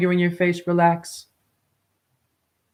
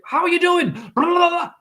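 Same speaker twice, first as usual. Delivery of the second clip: high, bright sing-song small talk; mocking a customer's scripted greeting; mock chirpy customer; 10 semitones higher than usual. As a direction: parody an overly cheerful greeting.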